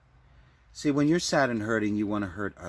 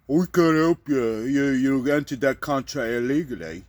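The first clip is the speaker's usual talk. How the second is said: British ambassador; 2 semitones higher, 5 dB louder than usual. Acted. Ambassador refusing help to the stranded caller, mock official voice. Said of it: "the ambassador told me".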